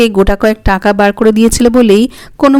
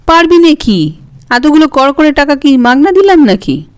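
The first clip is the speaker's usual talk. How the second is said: indignant retort; 6 semitones higher than usual. A narrator's reading. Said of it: "সঙ্গে সঙ্গে উত্তর দেয়".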